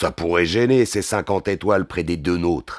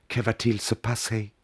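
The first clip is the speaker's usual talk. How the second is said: hushed woman's voice, much softer; Molly's voice; 2 semitones higher, 9 dB quieter than usual. A narrator's reading. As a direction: ask softly, as the female partner. Narrator voices Molly's question. (voix féminine feutrée)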